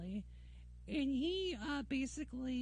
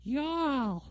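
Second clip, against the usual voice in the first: a louder, hammed-up Texan drawl on Y'all; mock Texan accent; 3 semitones higher, 7 dB louder than usual. Mocking Southerners, he drawls Y'all in a Texan accent. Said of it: "Y'all talking like a Texan".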